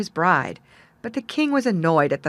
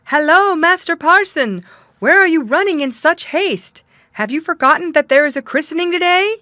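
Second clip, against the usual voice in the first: higher, loud call across the field; 9 semitones higher, 8 dB louder than usual. A narrator's reading. Clerk calling after the parson to stop running. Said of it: "cried out".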